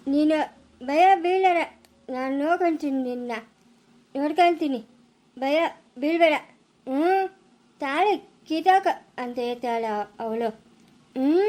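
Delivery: slow, broken phrases; halting reassurance. Acted. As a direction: drag the words out with effort. Stammering man reassures the frightened woman.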